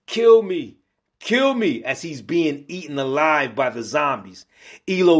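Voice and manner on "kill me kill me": high, pained cry for death; anguished plea. A narrator's reading. Warrior being eaten alive begs comrade, anguished high plea. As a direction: plead in agony, pitched well up.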